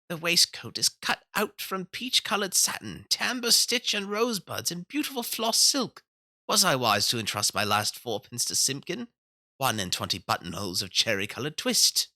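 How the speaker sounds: put-on high, fussy old man muttering over his work; fretful old tailor. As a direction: mumble like a worried old tailor by the fire. Old tailor mumbles to himself.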